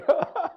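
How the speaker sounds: short, high, loud burst of laughter. Real, not acted. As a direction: burst out laughing at your own aside. Laughs after his own aside. (크게 터지는 웃음)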